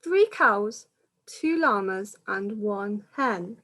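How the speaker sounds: lilting rhyming storybook read-aloud; reading to children. Acted. Reader telling children the pandemic story, lilting storytelling. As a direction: carry the rhyme along for little listeners.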